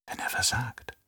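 very soft whisper to herself; hushed inner voice; whispered thought. A narrator's reading. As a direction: whisper it as an unspoken worry. Inner thought of worried mother.

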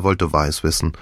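ordinary delivery, nothing marked

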